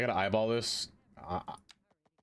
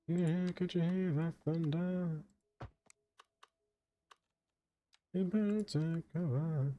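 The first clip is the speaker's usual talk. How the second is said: spooky voice; 7 semitones higher, 3 dB quieter than usual. Acted. Repeating spooky greeting.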